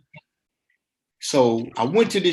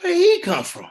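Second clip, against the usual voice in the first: much higher, amused disbelief; mock surprise; 13 semitones higher than usual. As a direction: jump up high in mock amazement. Stunned at new arrival.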